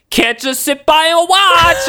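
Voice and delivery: high, drawn-out mock hero line; mock-heroic declaration. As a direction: proclaim it like a hammy hero. Mocking Watchdog's belated heroic line.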